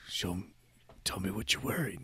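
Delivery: very soft, mock-sultry coaxing; creepy prank caller. Prank caller pressing the freaked-out man, mock-sultry. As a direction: coax in a hushed phone-creep voice.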